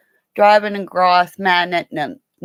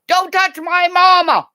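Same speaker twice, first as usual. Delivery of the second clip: higher and louder, a feisty little goat; protective goat voice; 10 semitones higher, 5 dB louder than usual. Pretend. Dwarf goat defending his mama from strangers, feisty high voice. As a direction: puff up like a tiny guard goat. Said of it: "He'll run up and go".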